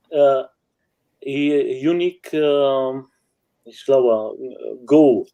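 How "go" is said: ordinary delivery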